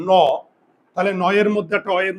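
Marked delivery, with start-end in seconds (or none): 0.1-0.1 "ন" alone as an open naw; single-letter sound. Shown as a demonstration. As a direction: sound "ন" with its inherent aw vowel. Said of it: "single ভাবে উচ্চারণ করতে যাই".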